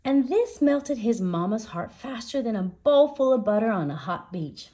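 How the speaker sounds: plain reading voice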